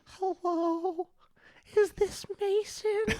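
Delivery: put-on high, slow old lady voice on the phone; old lady prank voice. Prankster on the phone to the kid, in an old lady voice. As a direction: croak it out like a granny crank calling.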